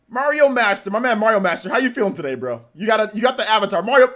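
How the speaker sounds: ordinary talk, nothing marked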